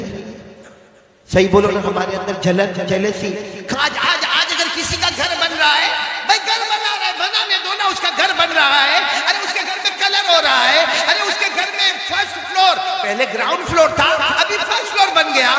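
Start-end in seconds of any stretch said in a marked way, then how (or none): 3.7-15.5 high, loud sermon build-up with laughter; impassioned preacher pitch; fiery preaching. Acted. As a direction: rouse the crowd, rising to a cry. Preaching at full force.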